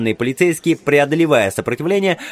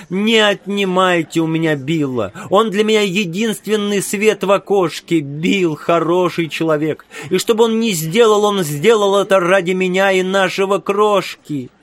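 pleading woman; 6 semitones higher than usual. A narrator's reading. Ragged wife pleads for husband.

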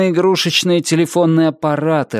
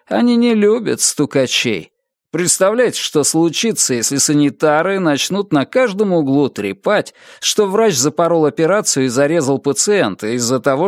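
plain reading voice

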